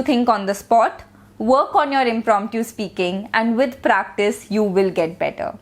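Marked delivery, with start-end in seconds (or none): none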